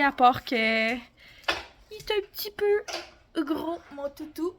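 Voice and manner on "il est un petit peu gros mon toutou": talking to a dog. Real, not acted. Doting on the chubby dog.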